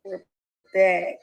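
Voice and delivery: ordinary talk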